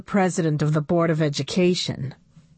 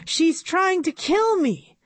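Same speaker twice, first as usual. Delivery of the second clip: panicked; 10 semitones higher than usual. A narrator's reading. Fleeing the robot in panic.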